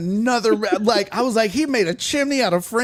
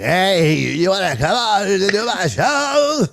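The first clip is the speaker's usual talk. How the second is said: imitating a shaky, quavering voice; shaky old radio host voice; slower than usual. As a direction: wobbly voice of an aging radio host. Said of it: "he had a shaky ass voice".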